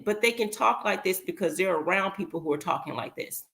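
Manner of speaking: normal talking voice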